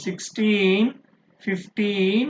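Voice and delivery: put-on sixteen and fifteen with a drawn-out teen; clearly pronounced teen. Shown as a demonstration. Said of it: "these must be clearly pronounced". (sixteen, fifteen లో teen స్పష్టంగా, ఒత్తి)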